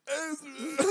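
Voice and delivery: high, loud mock cry of agony; hammed-up Rocky yelling for Adrian; agonized yell. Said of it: "When he's yelling in agony".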